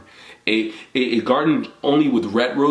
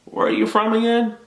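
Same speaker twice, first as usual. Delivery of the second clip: imitated curious questioner; 10 semitones higher than usual. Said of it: "someone would say".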